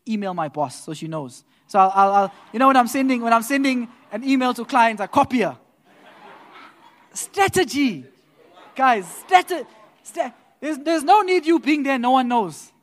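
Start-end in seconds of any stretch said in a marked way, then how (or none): 1.7-12.7 excited, louder, higher scheming brag; animated scheming. Retold or imitated. Telling the congregation his email trick, excitedly. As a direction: sell the sneaky strategy with glee. Boasting about copying the boss.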